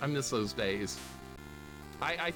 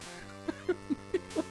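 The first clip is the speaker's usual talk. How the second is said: high, breathy laughter; exasperated laugh; 4 dB quieter than usual. Real, not acted. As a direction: break up laughing mid-rant. Cracking up at bad game.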